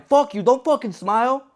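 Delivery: telling off the coworker who smiles it off, angry outburst; pissed off. Retold or imitated. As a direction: lay into him, fast at the end. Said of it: "I'm still pissed laying it on him".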